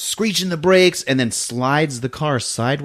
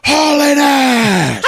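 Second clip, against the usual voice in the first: high, loud, stretched shout; hammed-up drawn-out cry; hauling-ass yell; 13 semitones higher, 5 dB louder, much slower than usual. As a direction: holler it slowly as a charging dog. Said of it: "that are just like".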